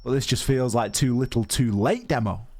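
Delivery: high, quick play-by-play call; esports caster. Acted. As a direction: call the action live as it happens. Live play-by-play of the round.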